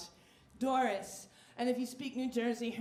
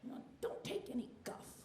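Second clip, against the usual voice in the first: grandma voice; 10 dB quieter than usual. Retold or imitated. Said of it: "She's like".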